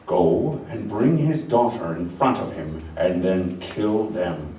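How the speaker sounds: cold villain order. Acted.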